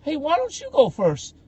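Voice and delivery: high, loud mock-polite offer; imitated nervous visitors. Visitors urge another to lead, high mock-polite voice. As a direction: pitch it way up to push someone ahead. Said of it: "and then they'd be like".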